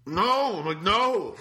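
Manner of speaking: high, loud mock wail of no; dismayed no. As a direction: cry out in disbelief. Shocked at wrong pizza.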